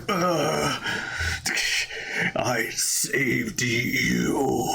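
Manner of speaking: very slow, fading last words; dying Ares. Retold or imitated. Defeated Ares gasping last words, slow. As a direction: let it drain out as he dies. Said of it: "He's like".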